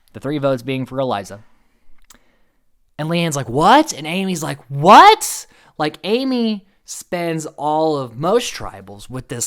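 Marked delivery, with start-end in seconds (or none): 3.5-3.9 shocked. Retold or imitated. Leanne shocked at being voted out. 4.7-5.5 high, loud disbelieving what; shocked. Retold or imitated. Amy shocked at the vote.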